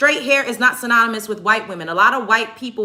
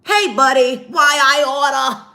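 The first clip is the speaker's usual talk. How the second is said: louder, put-on voice, slow on the order line; 4 semitones higher, 5 dB louder than usual. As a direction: ham up a chummy customer. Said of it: "We do not walk around talking like that".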